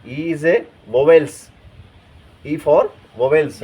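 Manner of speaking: very slow and spelled out; slow teacher drill. Shown as a demonstration. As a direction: drag each word for students to copy. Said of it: "ई आ रहा है".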